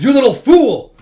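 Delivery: loud, high outburst at a junior; angry scolding. Retold or imitated. Senior scolds junior for ditching classes, angry shout. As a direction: explode into a loud mock telling-off.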